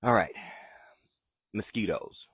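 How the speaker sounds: usual speaking voice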